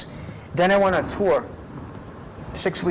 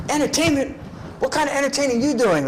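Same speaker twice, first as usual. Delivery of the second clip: high, doubtful sergeant voice, a touch mocking; skeptical drill sergeant; 9 semitones higher than usual. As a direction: play the sergeant who can't believe it. Drill sergeant questions him at 3am.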